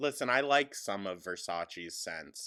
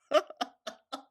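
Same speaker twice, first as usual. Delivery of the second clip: high laugh; 5 dB louder than usual. Real, not acted. Laughing at own bit.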